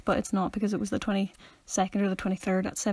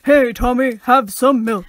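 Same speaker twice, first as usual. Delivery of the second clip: big, loud advert-mascot voice; cartoon cow character; 9 dB louder, slower than usual. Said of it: "then the cow's gonna be like".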